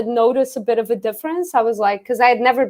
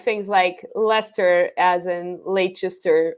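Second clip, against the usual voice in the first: usual speaking voice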